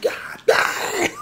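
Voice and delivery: high, breathy stunned cry; floored by it; stunned curse. Retold or imitated. Swearing in shock at the one punch, high and airy. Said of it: "I'm like".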